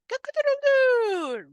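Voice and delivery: high crowing call; rooster crow. Shown as a demonstration. Teacher imitates rooster.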